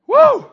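high loud whoop; excited whoop. Real, not acted. Preacher whooping to the church, joyful whoop. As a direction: let out a joyful woo.

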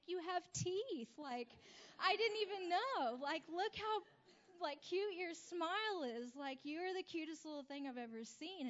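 high, loud, adoring gush; high, loud gushing to the smiling boy; gushing delight. Retold or imitated. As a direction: coo over the child's smile. Gushing over the boy's smile.

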